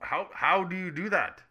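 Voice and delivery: normal talking voice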